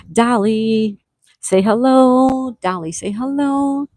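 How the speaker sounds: high, drawn-out singsong coaxing; slow singsong call to get Dolly to speak. Real, not acted. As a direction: coo it high, stretching out the hello. Coaxing Dolly to greet viewers.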